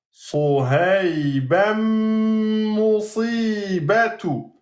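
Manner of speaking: Soheibamu musibatu slowly chanted with a falling end; slow Quranic recitation. Shown as a demonstration. Teacher chants the phrase for the class, slow and measured. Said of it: "tajwid reading demo".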